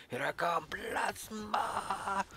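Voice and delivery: high, drawn-out battle cry; hammed-up kamikaze cry; charging kamikaze. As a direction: charge in with a stretched high yell. Acts out charging game character.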